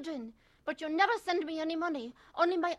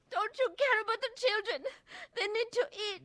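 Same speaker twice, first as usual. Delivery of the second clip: tearful plea; 10 semitones higher than usual. Acted. Wife pleading for the children.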